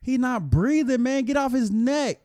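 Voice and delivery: high, loud pleading yell; crowd yelling. Retold or imitated. Bystander yelling at cop to get off neck, high pleading shout. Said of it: "everybody in the street like".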